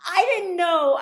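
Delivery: ordinary delivery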